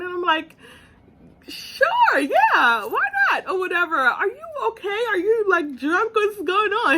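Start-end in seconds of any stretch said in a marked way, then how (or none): 1.5-6.9 much higher, incredulous questions tumbling out; baffled surprise. Retold or imitated. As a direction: sound thrown by his weird tone. Reacting to odd proposal.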